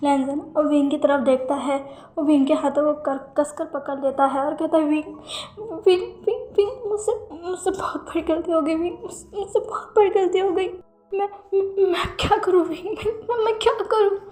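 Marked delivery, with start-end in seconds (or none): 4.8-14.2 high, broken, tearful pleading; a lover confessing his mistake through tears; crying. A narrator's reading. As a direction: stammer through sobs, voice cracking higher. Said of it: "जब रोते हुए ये कह रहा था".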